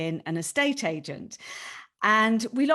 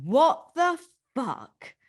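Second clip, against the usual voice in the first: higher, slow, drawn-out baffled exclamation; a retold gasp of disbelief, pitched up and slow; stunned disbelief; 6 semitones higher, 3 dB louder, much slower than usual. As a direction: replay her own bewildered reaction, stretched out slowly. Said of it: "And I just remember going".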